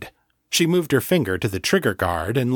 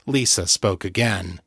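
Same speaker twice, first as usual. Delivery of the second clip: usual speaking voice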